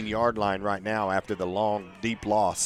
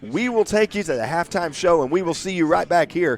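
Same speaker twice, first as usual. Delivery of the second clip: excited announcer; 6 semitones higher, 7 dB louder than usual. Real, not acted. Announcer signing off at halftime.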